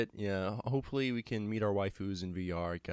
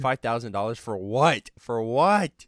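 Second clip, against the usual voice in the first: loud, indignant question; outraged; 2 semitones higher, 9 dB louder than usual. Real, not acted. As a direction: demand it, rising loud.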